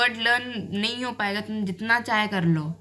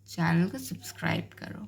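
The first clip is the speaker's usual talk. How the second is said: soft whispered aside; 2 semitones lower, 6 dB quieter than usual. Real, not acted. Host whispering to viewers to subscribe, in a whisper.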